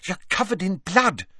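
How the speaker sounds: alarmed. A narrator's reading. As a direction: blurt it out alarmed. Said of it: "said Hermione".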